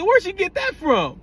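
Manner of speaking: high, slow, incredulous ask; surprised question. Retold or imitated. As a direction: act amazed at where she picked that up. Retold surprise at her accent.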